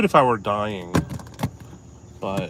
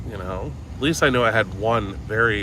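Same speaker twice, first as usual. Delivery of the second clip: ordinary delivery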